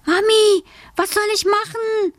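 put-on high, pleading child's call; girl calling Mummy. A narrator's reading. Alice asks her mother for help, high and calling. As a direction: call out as a helpless little girl.

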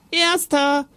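high-pitched triumphant cry; boyish shout. A narrator's reading. Jonathan cutting off father's scolding, shouting high. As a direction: call it out high like a winning child. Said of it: "da schrie Jonathan".

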